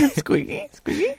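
squeaky said high through giggles; laughing repeat. Real, not acted. Cohost teasing the word squeaky, giggling high.

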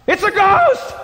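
loud, high-pitched acted cry of fright; terrified yell. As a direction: yell out like scared men seeing a ghost. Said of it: "and they yelled out".

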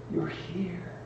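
soft, high, breathless wonder; barely voiced, full of wonder; tender whisper. Dying mother greeting son.

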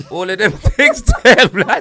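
high loud laugh through the words; words swallowed by a laugh. Real, not acted. Listing the rapper's song names, bursting out laughing.

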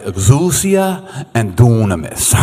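slow, drawn-out mock Italian accent. Shown as a demonstration. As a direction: put on a playful Italian accent, stretching the vowels. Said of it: "say it with an Italian accent".

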